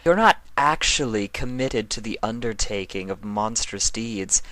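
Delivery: cold, regal and stern. Acted. Nightmare Moon pinning down Discord, a stern regal voice. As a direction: lay it out like a verdict. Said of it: "replied sternly".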